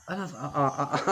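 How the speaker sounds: stuttering, stifled chuckle; suppressed laughter. Retold or imitated. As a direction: stammer through a held-back laugh. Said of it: "I'm trying not to laugh".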